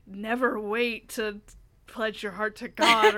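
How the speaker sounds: slow, drawn-out devil voiceover. Retold or imitated. Friend retelling the video's closing voiceover, devil voice. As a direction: intone it slowly like a mock demon. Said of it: "in a devil voice".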